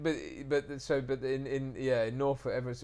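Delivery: ordinary delivery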